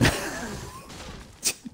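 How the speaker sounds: breathless high giggle; high squeaky laugh. Real, not acted. Laughing at friend's singing.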